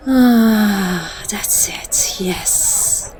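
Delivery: breathless whispered sighs as she's bitten; hushed, unvoiced and intimate; whispered pleasure. Vampire lover to the human biting her, breathy whisper.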